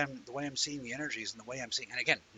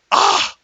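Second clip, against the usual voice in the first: high, loud shriek of defeat; embarrassed yelp; 19 dB louder than usual. Real, not acted. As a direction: cry out as the joke lands. Embarrassed outburst at the joke.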